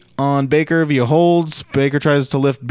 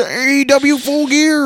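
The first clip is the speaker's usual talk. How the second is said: hammed-up announcement; 13 semitones higher, 4 dB louder, slower than usual. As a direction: pitch climbing high on the show name. Hammed-up announcement of the show name.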